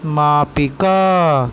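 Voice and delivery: slow, syllable by syllable मापिका; मापिका धीरे-धीरे; slow teaching word. Shown as a demonstration. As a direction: spell out मापिका slowly for kids. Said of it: "जिसे आप फुट्टा बोलते हैं ना".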